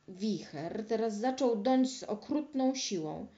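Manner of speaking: ordinary delivery, nothing marked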